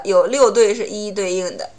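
ordinary talk, nothing marked

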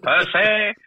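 high, loud comic catchphrase; Ford's catchphrase impression. Director quoting a sketch actor's catchphrase, high hammed-up impression. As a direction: call it out high and drawn-out, for laughs. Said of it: "Ford was hilarious at it. It was like".

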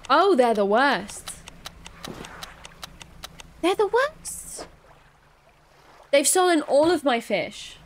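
loud, indignant complaint; exasperated outrage. Real, not acted. Player robbed of all the fish, exasperated outrage. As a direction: complain loudly at the thieves.

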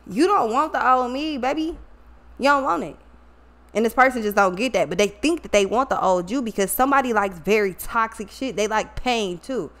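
usual speaking voice

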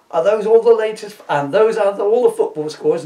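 loud, high, brash sports-presenter bark; mimicking the sports presenter's blaring read; mock sports presenter. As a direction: ham up a brash sports announcer.

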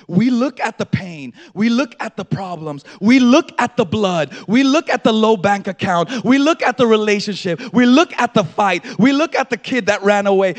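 loud, driving list; full-force preaching. Real, not acted. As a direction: hammer each phrase in parallel.

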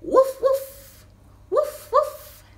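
very high, loud yapping woofs; dog bark. Pretend. Reading a farm book aloud, barking like a small dog. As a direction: bark like a little excited dog. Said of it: "A dog?".

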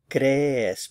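"crees" as CRE-es, first syllable strong and drawn; teacherly CRE-es for "crees"; stress on first syllable. Shown as a demonstration. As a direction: punch the "cre" of "crees", then fall. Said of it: "the stress is on this first syllable".

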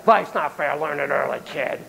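soft, fading brush-off; a muttered parent's brush-off; dismissive parent. Retold or imitated. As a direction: shrug it off at the kid. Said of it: "instead of".

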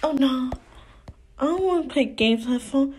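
ordinary delivery

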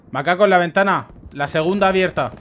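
loud sharp warning to the team; urgent callout. Real, not acted. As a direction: call it out fast and loud. Calling enemy position to team.

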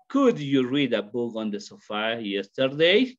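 slow, spaced-out question, YESTERDAY rising at the end; question intonation. Shown as a demonstration. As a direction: model the question slowly, lifting "yesterday". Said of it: "It is a question".

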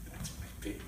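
hushed, barely voiced pride; proud whisper. Father watching daughter tell the story, proud whisper. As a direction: whisper it privately, almost to yourself. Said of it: "I'm sitting there like".

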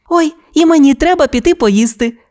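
usual speaking voice

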